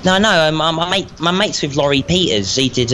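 ordinary talk, nothing marked

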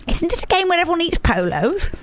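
a hint of Nat's voice; imitating Nat. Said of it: "that's what Nat should have said".

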